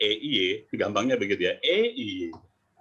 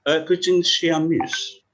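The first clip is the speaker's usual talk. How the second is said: put-on high, slow English-accented shopper voice; slow mock foreign-accented question; 6 semitones higher, 4 dB louder, slower than usual. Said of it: "itu dia katanya".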